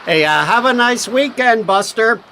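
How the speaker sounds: mocking send-off. Cop teasing the detective as he leaves, sarcastic. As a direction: rub it in with a mocking grin. Cop mocks Harry's Martian story.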